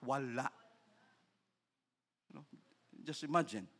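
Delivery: usual speaking voice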